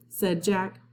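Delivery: plain reading voice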